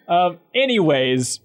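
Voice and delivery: ordinary talk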